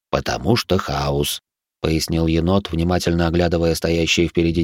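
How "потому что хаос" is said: low slow raccoon voice; lightly voiced calm raccoon.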